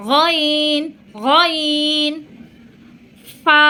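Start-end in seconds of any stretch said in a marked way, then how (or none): 0.1-2.1 গইন gargled from the top of the throat; গইন rough from the throat's end; Arabic ghain. Shown as a demonstration. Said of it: "গলার ঠিক শেষ অংশ থেকে".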